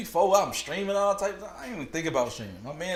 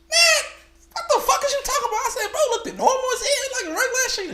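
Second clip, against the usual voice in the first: much higher, fast, laughing disbelief; shocked and laughing; 3 dB louder, faster than usual. Retold or imitated. Retold shock, friend says cute.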